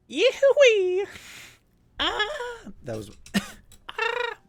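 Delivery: shrill squeaky goblin whoop, way up high and slow; hammed-up goblin cry, stretched out and very high. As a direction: squeal it like a crazed little goblin. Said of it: "I'm trying to do my Goblin Zeppelin voice".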